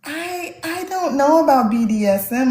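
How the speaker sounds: high, halting and unsure; retold hesitation. Author hedging to the conference host, high hesitant reenactment. Acting her hesitant reply to Nikki.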